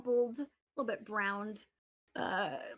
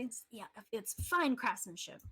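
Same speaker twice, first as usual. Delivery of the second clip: ordinary delivery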